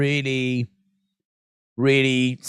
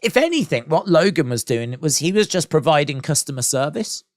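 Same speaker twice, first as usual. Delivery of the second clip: usual speaking voice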